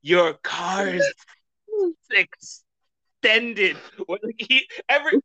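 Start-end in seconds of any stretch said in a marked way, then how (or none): 0.0-3.8 slow, hissing cartoon snake. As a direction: stretch the S's like a talking snake. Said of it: "He's a snake, so it would be like".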